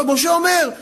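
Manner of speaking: ordinary talk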